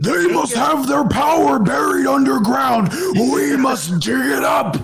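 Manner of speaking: hammed-up villain shout, high and drawn out; obsessed villain. Tyrannos obsessed with digging up buried power, hammy villain shout. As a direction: declare it like a cartoon overlord.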